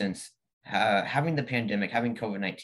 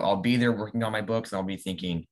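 normal talking voice

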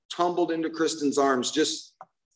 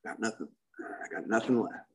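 hushed echo; 6 semitones lower, 9 dB quieter than usual. Retold or imitated. Echoing his hopeless thought.

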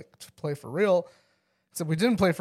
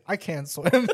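smug retort; slower than usual. Retold or imitated. Dad one-ups his kid about swimming, amused smug retort. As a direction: own pitch, amused and confident.

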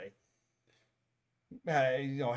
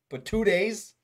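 louder, higher protest; exasperated disbelief; 7 semitones higher, 7 dB louder, slower than usual. Real, not acted. Man objects to holiday wishes sent early, exasperated. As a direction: hit both repeats in exasperation.

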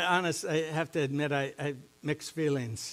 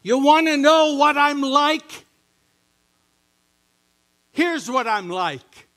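booming, higher and louder divine proclamation; voice of God; 10 semitones higher, 10 dB louder than usual. Retold or imitated. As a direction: announce it like God calling out to the world. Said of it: "it was like God was saying".

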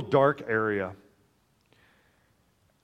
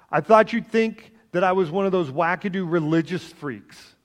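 raised, pleading cry back; retold desperate cry; 6 semitones higher, 6 dB louder than usual. Dreamer crying back to voices from the dark, pleading loudly. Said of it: "crying back to them".

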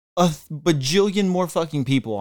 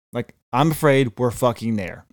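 ordinary talk, nothing marked

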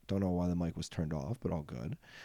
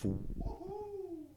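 much higher, breathy falsetto whoop; cheering; 8 dB quieter than usual. Acted. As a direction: celebrate with a squeaky woohoo. Cheering own comeback.

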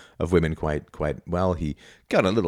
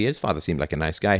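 ordinary talk, nothing marked

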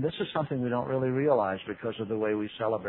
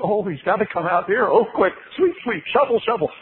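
higher, louder flustered angel voices; mock-frantic angels tidying the stable; nervous angels; 9 semitones higher, 5 dB louder than usual. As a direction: hurry like panicked angels sweeping up. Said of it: "the angels were out there nervously waiting".

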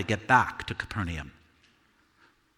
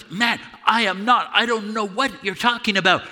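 much higher and louder, desperate denial; frantic loud denial; 8 semitones higher, 4 dB louder than usual. Acted. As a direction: blurt it out to shake them off. Peter denies knowing Jesus.

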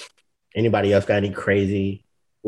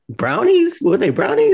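much higher, louder teasing squeak; ribbing a friend about the stolen brownies, high and teasing; mock disbelief; 5 dB louder than usual. Real, not acted. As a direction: jab at him with a high, teasing voice.

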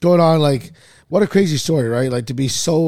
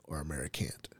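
breathy whisper, no voice; 11 semitones lower, 18 dB quieter than usual. Shown as a demonstration. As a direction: whisper the question like a secret. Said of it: "it's just for everybody here".

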